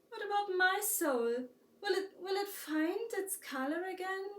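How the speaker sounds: high, tearful crying plea. A narrator's reading. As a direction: sob the question out, voice breaking. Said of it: "couldn't stop crying".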